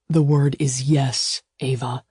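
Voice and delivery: slow, firm suitor's voice; insistent male lover. Acted. He presses her for a yes, slow and insistent. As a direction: press for the answer, slowly. Gideon's voice.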